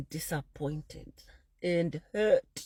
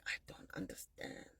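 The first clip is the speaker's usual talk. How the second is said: whispered in shock; stunned whisper; 18 dB quieter than usual. Retold or imitated. Whispering in shock at being told she destroyed her mother. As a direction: breathe it out, barely voiced.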